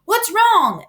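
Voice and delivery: announcer startled by the fainting pig; alarmed announcer. A narrator's reading. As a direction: call out in alarm. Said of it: "asked the loudspeaker".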